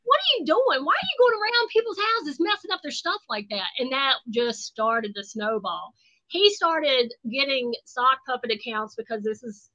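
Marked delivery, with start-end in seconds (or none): 0.0-3.7 high, indignant daughter voice; high-pitched mock scolding; daughter yelling. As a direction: play the furious teenage daughter. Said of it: "my daughter is like".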